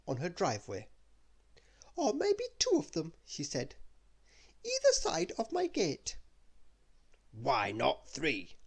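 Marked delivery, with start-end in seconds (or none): 2.0-3.1 high, grand lady voice; Little Miss Splendid. Acted. Little Miss Splendid to Mister Grumpy, high grand voice. 4.7-6.2 high, grand lady voice; Little Miss Splendid. Acted. Little Miss Splendid to Mister Grumpy, high grand voice. 7.3-8.6 slow, low grouchy mutter; grumpy mutter. Acted. Mister Grumpy grumbling back at Splendid, slow grouchy mutter. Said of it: "muttered Mister Grumpy".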